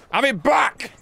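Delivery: high-pitched fighting yell; combative shout. Real, not acted. Gamer striking back at a zombie, shouting.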